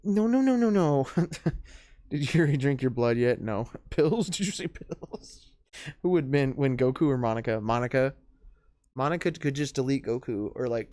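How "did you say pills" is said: whispered aside. Real, not acted. Reacting to pill bottle remark.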